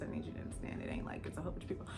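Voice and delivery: fast, hushed, conspiratorial whisper; whispered aside. Real, not acted. Telling the audience how small the class was, in a fast whisper. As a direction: lean in and whisper it quickly.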